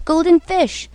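high calling voice across the waves. Acted. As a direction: call the fish from the shore. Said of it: "he called".

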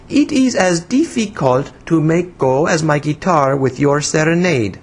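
put-on high, childish complaint with foreign accent; pouting. A narrator's reading. Woman grumbling that his name is difficult, pouting. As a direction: sulk in high broken English. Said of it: "she said poutingly".

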